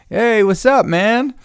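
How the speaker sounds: high, loud fake-friendly hey; overly cheery greeting. Greeting the guy he hoped to avoid, high fake-cheerful. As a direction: put on a big bright hello.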